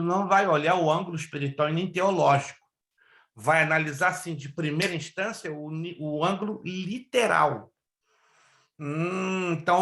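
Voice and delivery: ordinary talk, nothing marked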